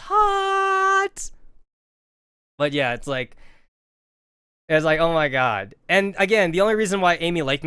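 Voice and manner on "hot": mock swoon. Real, not acted. Comic emphasis, high slow.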